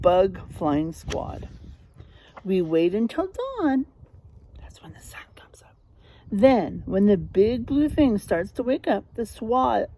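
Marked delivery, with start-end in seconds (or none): 4.6-5.7 quick hushed whisper; whispered aside. A narrator's reading. Teacher whispering an explanation to the class. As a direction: let the children in on a secret.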